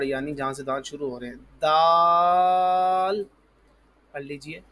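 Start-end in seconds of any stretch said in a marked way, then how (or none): 1.6-3.2 دال with tongue tip at upper tooth roots, vowel held long; tongue-tip daal. Shown as a demonstration. Teacher models the letter for pupils, long, loud دال. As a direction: sustain دال loudly on one note. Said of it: "اوپر والے دانتوں کی جڑ".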